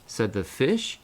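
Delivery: plain reading voice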